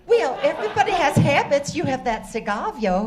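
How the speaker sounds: put-on Southern accent. Contestant flirts back at the quizmaster in Southern accent. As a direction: play the Southern belle lightly. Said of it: "With that accent, you sound like you're from Alabama or Texas".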